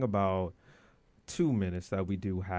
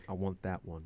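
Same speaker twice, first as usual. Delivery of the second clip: low guy voice; 2 semitones lower, 6 dB quieter than usual. Retold or imitated. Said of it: "the term amongst the gentlemen".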